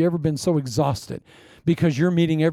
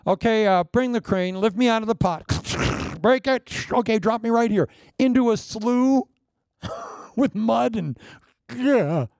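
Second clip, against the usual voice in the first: higher, hammed-up foreman giving orders; mock bossy self; 6 semitones higher than usual. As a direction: playfully act out bossing God's work around, laughing. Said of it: "now, if I were running things".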